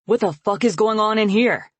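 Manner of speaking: loud, high furious shout; bellow. Acted. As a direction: bellow from the doorway. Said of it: "Colan bellows".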